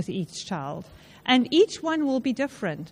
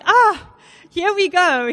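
retold exasperation; 6 semitones higher, 7 dB louder, slower than usual. Retells her own reaction that night.

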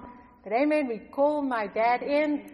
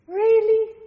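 incredulous; 10 semitones higher, 9 dB louder than usual. Retold or imitated. Doubting whether baptism is needed.

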